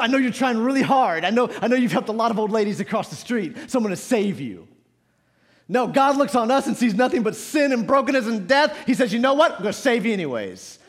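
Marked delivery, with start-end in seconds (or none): 5.7-10.8 raised, punchy pulpit delivery; forceful preaching. Real, not acted. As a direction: preach it with full conviction.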